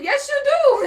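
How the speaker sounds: high, laughing comeback; laughing retort. Retold or imitated. Contestant snapping back at her man, laughing.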